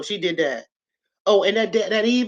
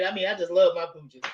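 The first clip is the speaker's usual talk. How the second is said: ordinary delivery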